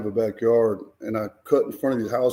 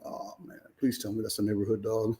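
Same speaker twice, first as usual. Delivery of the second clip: scared whisper; 9 dB quieter than usual. Retold or imitated. Hiding, hearing the dogs approach.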